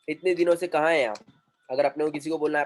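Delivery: ordinary delivery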